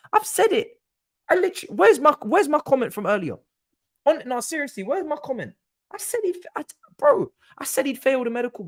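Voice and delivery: very high-pitched, worked-up; frantic and vindicated; agitated. Real, not acted. Streamer hunting his earlier prediction, worked up and high.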